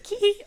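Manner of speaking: very high, short squeaky kee; squeaked "kee". Shown as a demonstration. Host showing the noise he made in class, high squeak. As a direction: mimic a squeak on a mouth call. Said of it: "I'd do, like".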